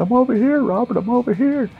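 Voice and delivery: high, put-on come-find-me call; mock calling out. Indy luring Robert around the thicket, high pretend call. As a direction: call across the woods in a high voice. Fake victim calls to his searcher.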